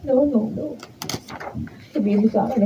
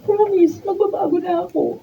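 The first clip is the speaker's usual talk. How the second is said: desperate pleading; 7 semitones higher than usual. Acted. Wife pleads with husband.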